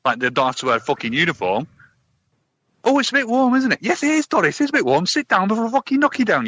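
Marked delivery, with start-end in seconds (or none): 2.8-3.8 high-pitched small-talk mimicry; put-on high passenger voice; mock moaning passenger. Mimics bus passengers complaining of heat. 3.8-6.5 high, worked-up comic rant; hammed-up fed-up bus driver; mock exasperated retort. Acted driver's reply to passenger.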